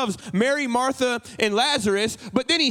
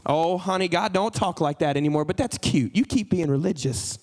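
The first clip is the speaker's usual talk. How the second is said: low, patronising put-down; condescending friend; 6 semitones lower than usual. Acted. Friend brushing off talk of God speaking, low and condescending. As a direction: lower the voice and humour them dismissively.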